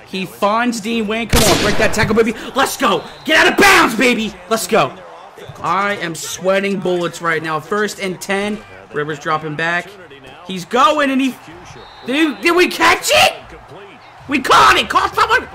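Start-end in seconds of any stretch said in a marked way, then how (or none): 3.2-4.3 high, loud urging yell; frantic shout; urgent shout. Real, not acted. Urging his player during play. 12.1-15.5 high, loud disbelieving yells; frantic excited shout. Real, not acted. Tense moment of play.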